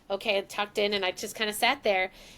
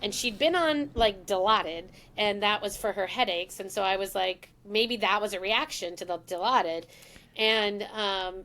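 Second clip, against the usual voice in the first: usual speaking voice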